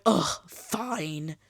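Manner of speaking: grudging, put-upon groan of giving in; exaggerated huffy concession; mock exasperation. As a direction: imitate a fed-up doctor reluctantly relenting. Mimics doctor grudgingly giving in.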